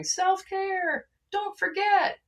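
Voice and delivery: high, sing-song mock of self-care gurus. Mocking the self-care crowd in a high sing-song voice. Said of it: "everybody that's going".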